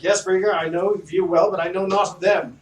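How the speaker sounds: high, loud haughty noble voice; pompous mayor. Acted. Mayor accusing the party of ruining his silk, haughty and loud. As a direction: look down your nose at them. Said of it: "He's like".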